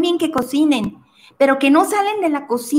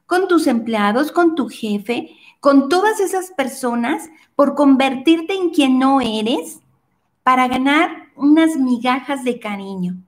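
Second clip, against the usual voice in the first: ordinary talk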